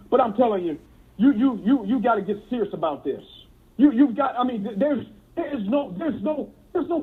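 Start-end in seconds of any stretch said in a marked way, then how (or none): none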